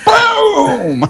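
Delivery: very high, drawn-out shout; whooped-out blast noise, high and long; shouted boom. Acted. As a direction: shout an explosion. Player voices the blast in game.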